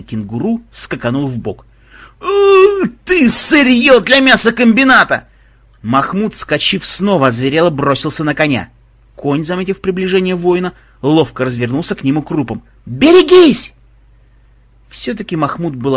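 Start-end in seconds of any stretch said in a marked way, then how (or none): 2.2-5.3 high, loud wailing curse; enraged yell. Acted. Mahmud cursing the horse that dodged him, enraged howl. 12.9-13.7 very high, loud cry; warning shout. Acted. Warning as the horse turns to kick, high shout.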